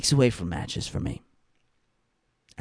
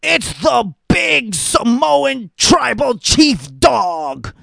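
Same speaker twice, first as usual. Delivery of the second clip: high, loud, slow mock hype call; hammed-up announcer shout; 12 semitones higher, 4 dB louder, much slower than usual. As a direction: bellow the title like a cheesy ring announcer.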